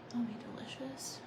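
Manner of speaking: soft unvoiced whisper to herself; quiet private whisper. Real, not acted. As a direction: murmur the plan under your breath.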